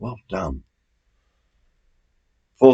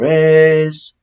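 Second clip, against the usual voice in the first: drawn out slowly, pitched higher and louder; suspense build-up; 9 semitones higher, 6 dB louder than usual. Acted. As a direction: stretch it to tease the answer. Quiz host building suspense.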